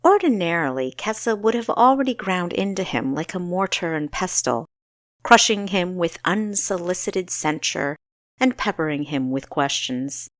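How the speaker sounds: ordinary delivery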